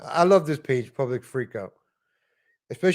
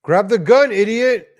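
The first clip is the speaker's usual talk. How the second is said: real loud, higher shout at the screen; yelling at video; 5 semitones higher, 9 dB louder, slower than usual. Real, not acted. Vlogger yelling at a robbery victim on video, loud shout. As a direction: bark it at the guy in the clip.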